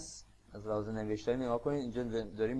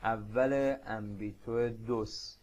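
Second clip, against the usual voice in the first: slow careful reading; much slower than usual. Shown as a demonstration. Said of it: "بریم سراغ".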